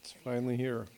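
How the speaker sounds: hushed aside before starting; whisper. Real, not acted. Speaker at the podium whispering to himself before starting.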